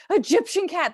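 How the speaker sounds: high, loud geeky thrill; dorky excitement. Retold or imitated. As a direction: blurt it out like a delighted nerd. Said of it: "I got, like, so dorky".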